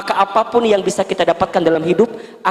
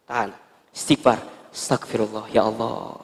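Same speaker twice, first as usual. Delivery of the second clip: hushed istighfar; 8 semitones lower, 7 dB quieter than usual. Shown as a demonstration. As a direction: murmur it low to calm yourself. Wife calming herself, hushed. (istighfar lirih dan rendah)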